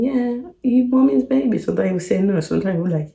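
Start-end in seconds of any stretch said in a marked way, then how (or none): 0.0-1.6 high, childish imitation of a son; little boy voice. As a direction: chirp it like a small child. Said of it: "he's still saying".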